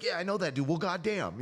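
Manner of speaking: high, loud fan greeting; imitating a thrilled stranger; excited fan impression. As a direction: blurt it out like a fan spotting someone. Voicing excited fans who recognize him.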